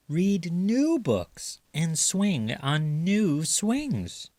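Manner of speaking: lifted, sing-song encouraging voice; coaxing mother raccoon. A narrator's reading. Mother raccoon cheering Chester about school, sing-song. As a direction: brighten it up to win him over.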